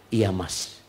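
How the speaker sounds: soft, low compliant reply; meek wife. Acted. Wife obeying husband's call, meek and soft. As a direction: give in softly, voice dropped low.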